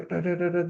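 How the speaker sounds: high mock scolding rattle; a quick burst of imitated nagging; nagging wife noise. Wife angry again, imitated scolding babble. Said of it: "ini marah lagi".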